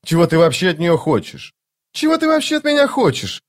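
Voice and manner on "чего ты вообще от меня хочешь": much higher, outraged question; indignant. A narrator's reading. Vaza demanding what the thief wants, indignant and high. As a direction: flare up, offended. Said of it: "возмутилась Ваза".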